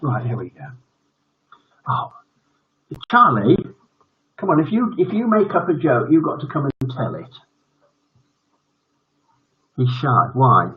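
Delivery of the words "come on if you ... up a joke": ordinary delivery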